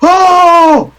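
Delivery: high-pitched loud horrified wailing; bystander's high scream of alarm. Acted. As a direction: cry out in panic, drawing out each "oh". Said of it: "he screams".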